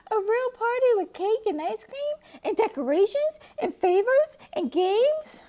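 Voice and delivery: high, loud, eager cubs' shout; loud and high with excitement; excited shouting. A narrator's reading. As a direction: shout it out together, thrilled. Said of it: "took turns shouting".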